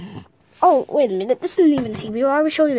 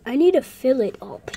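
ordinary talk

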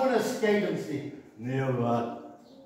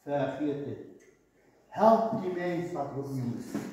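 voice of God; 8 semitones lower, 5 dB quieter than usual. Retold or imitated. Preacher voicing God rejecting false love, low and soft. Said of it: "God zegt".

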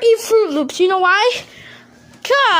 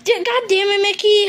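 ordinary talk

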